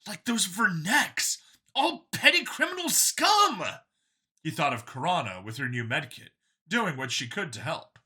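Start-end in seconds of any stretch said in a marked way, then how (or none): 0.1-3.6 higher, louder, keen voice; a cheerful zealot pitched up; android copy, eager. A narrator's reading. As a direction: sell it like a proud believer. Copy insults species.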